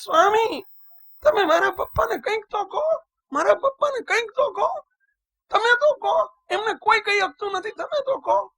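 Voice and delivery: put-on high, pleading child's wail; imitating a sobbing child. A monk imitates the crying boy begging him to speak to his father. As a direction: beg through tears like a desperate boy. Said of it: "રડતો જાય ને બોલતો જાય".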